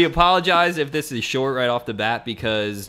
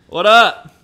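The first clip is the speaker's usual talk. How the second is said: loud, excited greeting; hyped shout; 8 semitones higher, 12 dB louder than usual. Real, not acted. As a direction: shout a hype hello into the phone. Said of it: "We just hyped you up so hard".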